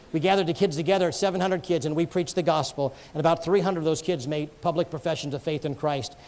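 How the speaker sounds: ordinary delivery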